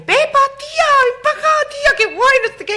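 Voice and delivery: high excited shrieking call; yelling friends. Retold or imitated. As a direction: squeal it like teenage girls across a park. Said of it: "empiezan a gritarle". (grito agudo y emocionado de amigas)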